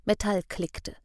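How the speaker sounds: ordinary delivery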